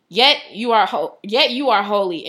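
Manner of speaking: ordinary delivery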